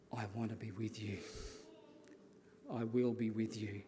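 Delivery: soft, low, hushed reassurance; tender promise. Acted. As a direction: voice God's promise quietly and gently. Voicing God's tender promise.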